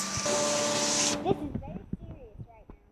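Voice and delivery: very soft, slow, near-whispered report; hushed seriousness. Real, not acted. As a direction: hush it like a grave bulletin. Mock-serious report to camera.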